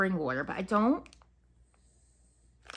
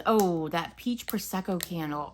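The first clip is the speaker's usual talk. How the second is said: usual speaking voice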